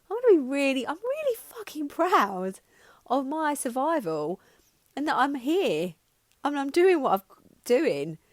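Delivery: tearful defiant pride; emotional, near tears. Real, not acted. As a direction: push through the emotion with pride. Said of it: "I'm getting upset, or getting emotional".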